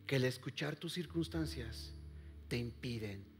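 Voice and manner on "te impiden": whispered, fading to almost nothing; dramatic whisper. Acted. Pastor to the congregation, whispering dramatically. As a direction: pull them in with a breathy hush.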